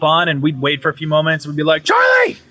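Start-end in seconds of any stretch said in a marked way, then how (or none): 1.8-2.4 high-pitched yell at a pesky roommate; mock-exasperated high holler; calling out. Retelling yells at a ghost.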